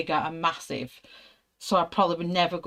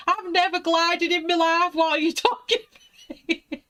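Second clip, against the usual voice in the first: high, louder, cracking into laughter; exasperated wail; 12 semitones higher, 3 dB louder than usual. Retold or imitated. Answering her husband's glide advice, high laughing exasperation. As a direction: fling it back at him, near tears and laughing.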